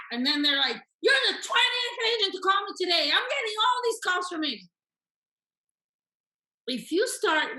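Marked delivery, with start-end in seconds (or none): 1.0-4.7 high, loud, rapid-fire complaint; agitated, frustrated seller. Acted. Coach playing seller fed up with agents' calls, agitated shouting. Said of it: "you feel agitated by the way".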